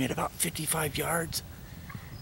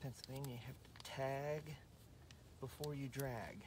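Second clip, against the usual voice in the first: quiet; 20 dB quieter than usual. Real, not acted. Closing tip said softly.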